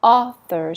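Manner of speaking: Author with the first syllable loud and strong; stress on the au. Shown as a demonstration. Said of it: "Stress on the ah".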